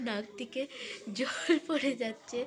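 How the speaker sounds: laughing. Real, not acted. Laughing at her runny nose.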